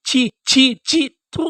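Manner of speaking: a high-pitched mocking "chhee chhee", spat out; disgusted jeer. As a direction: jeer at him like something filthy. Mocking rival player with disgust.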